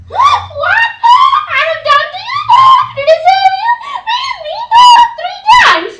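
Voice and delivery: high, loud, hammed-up donkey voice; the donkey protests to Balaam, high, loud and hammed-up; shouting donkey. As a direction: squeal it out like an outraged donkey. Donkey talks back in skit.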